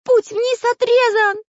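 very high, loud shout of despair; high-pitched despairing yell; desperate cry. A narrator's reading. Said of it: "закричал в отчаянии Карандаш".

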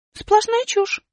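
высоко и громко, недоумевая; маленькая героиня возмущённо спрашивает; высокий удивлённый голос. Acted. Аля соглашается. (высокий удивлённый голос героини)

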